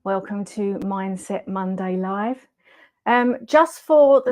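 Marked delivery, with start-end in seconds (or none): none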